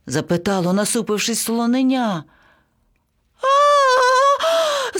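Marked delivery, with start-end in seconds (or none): 3.4-5.0 very high, sustained panicked squawk; parrot's scream. A narrator's reading. Parrot seeing the frown spread, screaming. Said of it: "зарепетував папуга".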